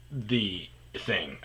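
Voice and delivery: usual speaking voice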